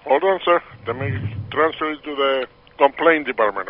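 ordinary talk

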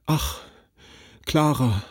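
mild, sighing lament; a touch of broken despair. A narrator's reading. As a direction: sigh her name out in hopeless grief. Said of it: "seufzte wie aufgelöst in trostlosem Jammer".

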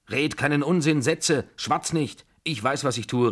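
high, loud, worked-up scolding; heated, imperious cry; agitated rebuke. A narrator's reading. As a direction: silence him, seething with excitement. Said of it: "rief sie, vor Erregung bebend".

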